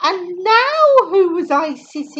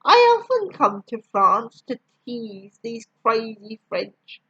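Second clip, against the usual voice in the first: ordinary delivery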